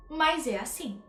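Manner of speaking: normal talking voice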